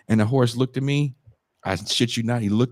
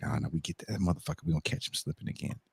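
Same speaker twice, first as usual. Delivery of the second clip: low, hushed, quick mutter; mock plotting under the breath; conspiratorial aside; 7 semitones lower, 12 dB quieter, faster than usual. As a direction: murmur it quickly, like workers scheming. Workers plotting aside, acted out.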